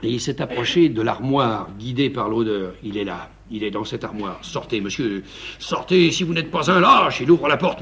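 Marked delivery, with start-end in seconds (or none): none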